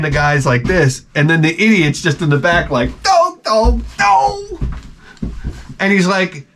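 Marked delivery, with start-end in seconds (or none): 3.0-4.6 high, whiny voice of the idiot; a high pleading idiot. Retold or imitated. Idiot in the back whining high at the guys.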